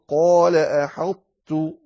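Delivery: slow "أحطت" with the ṭāʾ's heaviness held; emphatic ṭāʾ kept. Shown as a demonstration. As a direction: draw out "قال: أحطت", heavy ṭāʾ into t. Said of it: "فتقول".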